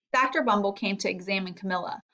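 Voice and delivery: ordinary delivery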